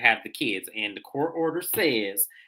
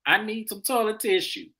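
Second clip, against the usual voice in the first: mock Allison; 8 semitones higher than usual. Mocking Allison knocking on Ralph's door.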